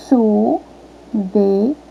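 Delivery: "Chuveiro" very slow, in three pieces; separação silábica. Shown as a demonstration. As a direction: stretch and separate each syllable of "Chuveiro". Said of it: "Quantas vezes a professora bateu palma". ("Chuveiro" bem devagar, sílaba por sílaba)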